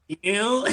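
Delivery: a quick pew imitated from the anime; anime sound effect. Friend imitating the anime sound effect, quick pew. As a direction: spit out a short zap noise. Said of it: "The sound".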